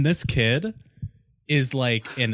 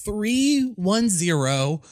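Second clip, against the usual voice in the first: put-on nervous kid voice; 5 semitones higher, slower than usual. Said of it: "And he's like".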